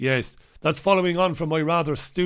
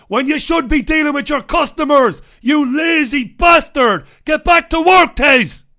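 loud, high yelling at a slacking friend; mock angry shout; 10 semitones higher, 10 dB louder than usual. Yelling at friend Tez to get back to work, mock furious shout. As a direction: bawl him out, half joking.

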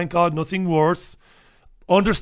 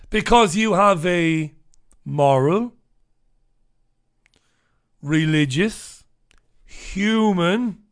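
slow, weighted words with pauses; preacher-like slow emphasis; slow emphatic list; much slower than usual. Real, not acted. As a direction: lay each word down with deliberate weight. Impassioned slow rhetoric.

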